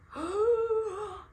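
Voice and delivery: sharp high gasp of delight. Real, not acted. Reader remembering a beloved book, delighted gasp. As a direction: gasp as if the book just hit you again. Gasping over favourite book.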